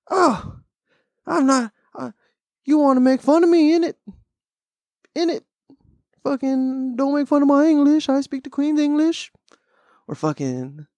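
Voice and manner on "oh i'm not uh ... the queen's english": put-on very high, goofy British accent; mock British accent. Offended Brit defending his English, mock British accent. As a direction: protest in a hammed-up British accent. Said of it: "funnier than a fucking British accent".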